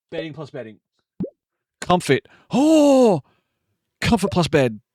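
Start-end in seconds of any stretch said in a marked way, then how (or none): 2.5-3.2 a sudden high, loud "Oh!"; surprised oh. Real, not acted. Streamer spots a new item and yelps in surprise. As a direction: yelp of delight at a new find.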